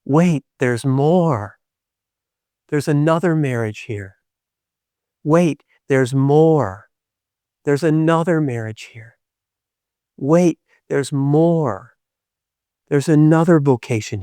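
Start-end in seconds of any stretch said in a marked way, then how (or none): 0.0-1.6 drawn-out and deliberate; slow refrain. Real, not acted. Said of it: "sermon refrain, slow". 5.2-6.9 drawn-out and deliberate; slow refrain. Real, not acted. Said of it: "sermon refrain, slow". 10.2-11.9 drawn-out and deliberate; slow refrain. Real, not acted. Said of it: "sermon refrain, slow".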